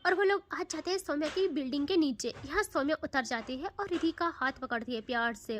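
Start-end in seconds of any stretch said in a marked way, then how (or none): none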